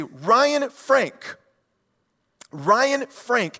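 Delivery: loud calling from across the house; mom yelling full name. Retold or imitated. Mom calling her son from across the house, stern loud call. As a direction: call him out like an angry mom. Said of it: "I would hear my mom".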